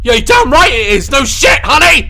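very high, loud shrieking yell; startled scream. Real, not acted. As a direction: scream at the sudden scare. Jump scare reaction.

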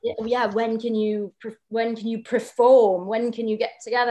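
imitated British accent, pressing for an answer; Dad's British accent, keen and insistent. Daughter imitating dad nagging her to perform, British accent. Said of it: "that's a pretty good accent there".

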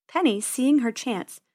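plain reading voice

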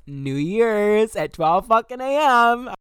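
drawn-out excited proclamation; hyped announcement. Real, not acted. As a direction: sell it like the big reveal. Announces his iconic coming-out moment.

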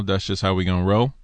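normal talking voice

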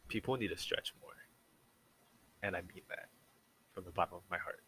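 quiet sincere. Real, not acted. Earnest plea to stretch more.

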